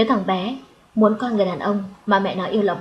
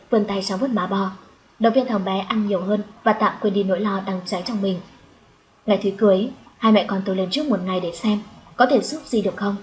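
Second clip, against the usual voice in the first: ordinary delivery, nothing marked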